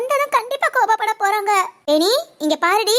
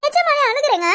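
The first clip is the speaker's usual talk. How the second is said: higher, whiny mock sobbing voice; fake crying; 4 semitones higher than usual. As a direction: overplay the tears to win him over. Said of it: "நடிக்கிற மாதிரி தெரியுது".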